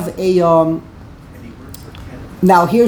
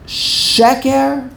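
Sheker pitched high, stressed on the first syllable; proper first-syllable stress; 6 semitones higher than usual. Shown as a demonstration. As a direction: hit SHE in Sheker. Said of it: "The proper way to say Sheker".

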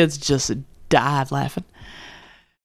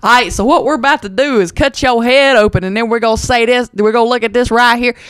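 louder, higher folksy Southern surgeon voice; twangy surgeon; 6 semitones higher, 5 dB louder than usual. Retold or imitated. Surgeon explaining the operation in a folksy Southern twang. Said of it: "If your brain surgeon walks around".

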